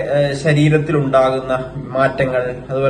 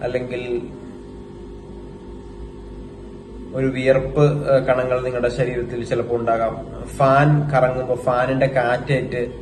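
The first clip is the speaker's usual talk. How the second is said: guided meditation; 3 semitones lower, 6 dB quieter than usual. Acted. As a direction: guide them into stillness, phrase by phrase, in an even tone. (ധ്യാനത്തിലേക്ക് നയിക്കുന്ന സാവധാന ശബ്ദം)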